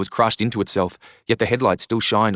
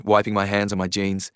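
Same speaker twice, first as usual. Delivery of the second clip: ordinary delivery, nothing marked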